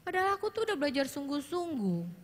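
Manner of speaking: lightly acted hurt teen; frustrated teen. Shown as a demonstration. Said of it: "ekspresi seperti itu". (anak kecewa sudah belajar keras)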